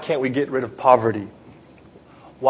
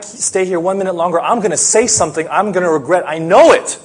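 higher, louder, desperate plea to God; venting at God, at the end of your rope; a parent about to lose it, begging God for help; frantic angry prayer; 4 semitones higher, 4 dB louder than usual. Acted. Acted angry parent praying.

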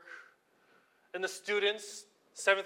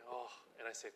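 ordinary talk, nothing marked

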